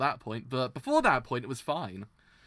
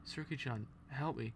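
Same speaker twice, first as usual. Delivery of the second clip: manga read aloud; 15 dB quieter than usual. Acted. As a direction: read the panels quietly, half acting them. Reads manga aloud voicing characters.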